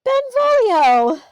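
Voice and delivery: high, drawn-out shout; loud sing-out of a name; calling out. Real, not acted. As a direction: call the name out long and loud, rising at the end. Calls out name while searching.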